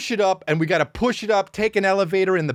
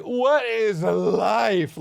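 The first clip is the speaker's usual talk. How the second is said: put-on very slow, higher voice of a bewildered baby; dazed baby voice; 5 semitones higher, much slower than usual. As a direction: lightly voice the baby, lost. Voicing the baby's confusion.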